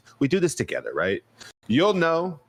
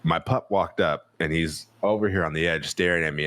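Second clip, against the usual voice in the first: ordinary delivery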